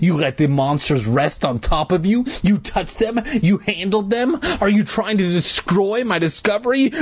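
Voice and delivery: high, rapid-fire accusing questions; angry ranting. A narrator's reading. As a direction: pile up the accusations, pitch rising. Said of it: "his face red with anger".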